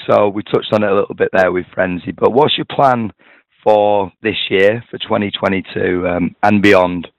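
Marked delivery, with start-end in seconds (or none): none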